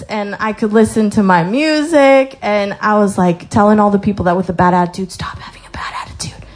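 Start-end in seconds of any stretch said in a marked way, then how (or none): 5.1-6.4 quick hushed whisper; whispered advice. Retold or imitated. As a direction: lean in and whisper the advice fast. Telling coworkers to change.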